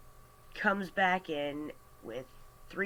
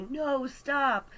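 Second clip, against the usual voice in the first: high, louder mock-panicked protest; alarmed warning; 9 semitones higher, 5 dB louder than usual. Brain trying to stop the mouth, alarmed. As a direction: cry it out like a frantic inner voice.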